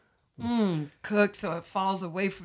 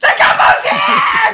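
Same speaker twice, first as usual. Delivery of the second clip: much higher, louder, slow shouted announcement; excited shout; 12 dB louder, slower than usual. Pretend. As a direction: yell "The gumbo's here!" across the yard. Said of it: "imagining the delivery arriving".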